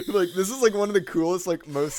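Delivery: high, loud and gushing; excited. Real, not acted. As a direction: burst out, thrilled about it. Gushing about the forgotten synths.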